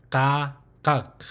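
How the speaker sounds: "kak" closed with a hard final k from the throat; closed syllable kak. Shown as a demonstration. Teacher demonstrating the closed syllable "kak". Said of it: "that's how you'll pronounce that consonant".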